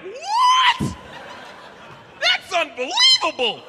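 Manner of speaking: high, loud shocked cry with a laugh; shocked shout. Retold or imitated. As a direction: yelp in disbelief. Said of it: "this guy's like".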